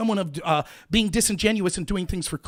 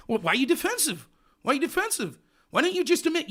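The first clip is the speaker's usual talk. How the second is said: higher-pitched mock accusation; badgering debater; 7 semitones higher than usual. Host imitating an opponent calling him defensive, badgering. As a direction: press the same accusing question, hammed up.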